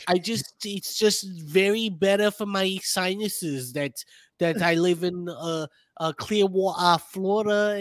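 lightly mock a New York retiree's accent.

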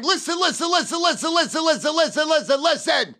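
high, loud rapid-fire listen; shouted listen. Retold or imitated. Salesman talking over a customer who won't stop. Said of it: "I kept going".

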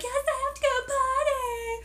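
put-on high, slow, drawn-out kid whine; insistent whining child. Young daughter insisting to Mom she must go, whiny. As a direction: imitate a child pleading, stretching it out.

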